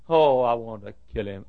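ordinary talk, nothing marked

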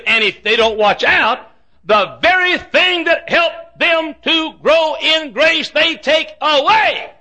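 high, slow rising preacher's wail; preaching. Real, not acted. Preacher warning the congregation, slow and high.

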